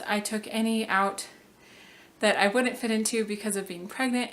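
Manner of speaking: ordinary talk, nothing marked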